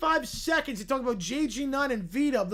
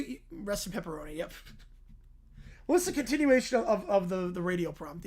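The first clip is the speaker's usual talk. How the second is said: normal talking voice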